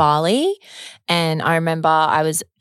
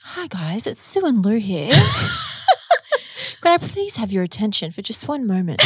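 flight attendant; 3 semitones higher than usual. Acted. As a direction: purr the welcome over the intercom. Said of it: "I feel like that voice is, like, kinda sexy".

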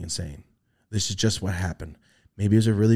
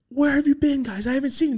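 much higher, eager reunion cry; excited greeting. Retold or imitated. Reunited with his cosmic friends, high excited greeting. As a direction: greet old friends, pitched way up.

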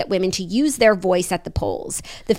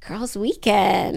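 drawn out; 3 semitones lower, much slower than usual. Real, not acted. Echoing the phrase playfully.

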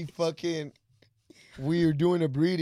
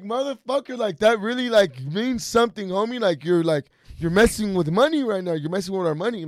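higher and louder, a retold telling-off; mock scolding; 4 semitones higher, 6 dB louder than usual. Retelling how he scolded Nick over the litter, mock scolding. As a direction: scold him like he cost you money.

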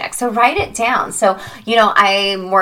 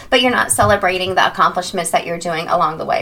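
ordinary talk, nothing marked

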